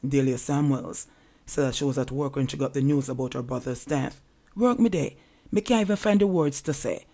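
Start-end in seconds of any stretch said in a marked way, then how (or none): 4.6-7.0 higher, louder reading of a distraught sister. A narrator's reading. Newsreader quoting a murdered man's sister, mildly distraught. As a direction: read her anguish with raised pitch, mildly acted. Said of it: "the distraught sister said".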